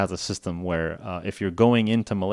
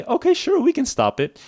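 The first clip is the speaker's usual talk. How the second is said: much higher, bright helpful caller; friendly agent voice; 5 dB louder than usual. Retold or imitated. Scam caller pretending to help, high and cheerful. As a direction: sound eager to help the victim.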